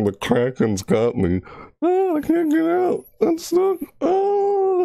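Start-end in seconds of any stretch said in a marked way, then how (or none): 1.8-4.8 very high falsetto mock distress; hammed-up squeal, as if trapped; screaming. Streamer acting out the Kraken's got me, high mock screaming. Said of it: "I'm screaming here because the".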